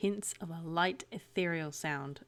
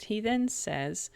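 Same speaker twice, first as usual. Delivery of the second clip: normal talking voice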